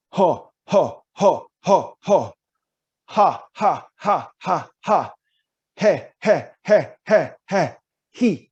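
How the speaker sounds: sharp, loud, high ho ha he hoo bursts from the belly; breathy diaphragm pulses. Shown as a demonstration. Voice coach drilling diaphragm pulses, loud and high. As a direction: punch each syllable out from the diaphragm. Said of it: "So I go".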